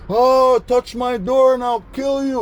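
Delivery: high, loud, slow shrieked threat through the door; furious threat. Retold or imitated. Roommate yelling from behind his slammed door, a high furious screech.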